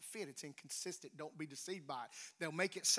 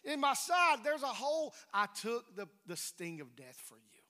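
voicing Jesus; 6 semitones higher, 4 dB louder than usual. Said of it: "Thomas, who doubted and said".

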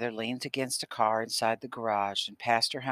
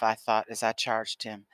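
plain reading voice

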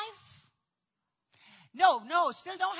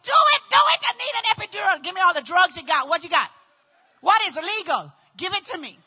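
desperate demand; 3 semitones higher, 8 dB louder, faster than usual. Retold or imitated. Patient demanding drugs from staff, mock desperate shouting. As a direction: shout frantic orders, hammed up.

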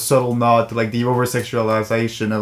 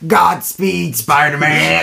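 raised, exclaimed movie quote; mock villain call; 4 semitones higher, 3 dB louder, much slower than usual. Quoting a film villain's call.

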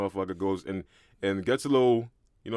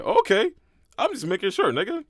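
mock reply pitched much higher and louder; playful high retort, louder; 11 semitones higher, 5 dB louder than usual. To a co-host who jokes, a much higher mock reply. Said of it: "I'm like".

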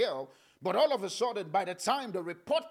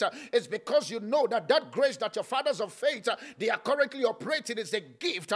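normal talking voice

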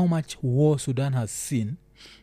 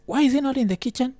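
mock outraged quote; 10 semitones higher than usual.

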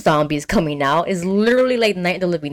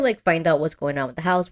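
ordinary delivery

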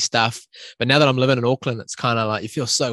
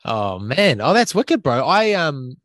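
ordinary talk

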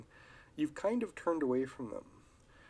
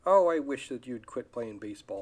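snide wife; 9 dB louder than usual. Retold or imitated. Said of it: "she says something snide".

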